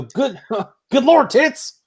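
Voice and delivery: high, slow exclamation breaking into laughter; the exasperated catchphrase, laughing through it; mock exasperated tone. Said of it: "I get that tone".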